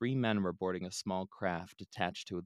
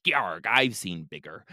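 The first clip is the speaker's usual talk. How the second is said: gruff pirate captain; 9 dB louder, slower than usual. Acted. Captain brushes off ice wall.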